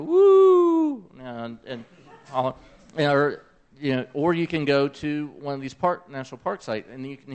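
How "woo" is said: high, loud whooping cheer like an eclipse crowd. Retold or imitated. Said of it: "and you can hear everybody go".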